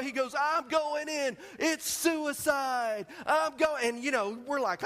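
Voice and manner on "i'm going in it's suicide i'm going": high, stretched-out heroic declaration; hammed-up desperate resolve; dramatic action hero. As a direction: play the hero charging in, every word drawn out. Acting hero's reckless resolve on phone.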